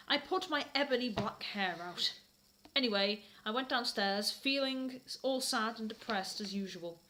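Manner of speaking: usual speaking voice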